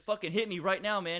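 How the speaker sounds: high, loud retold taunt; belligerent dare. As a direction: dare him to throw a punch. Customer daring him to hit.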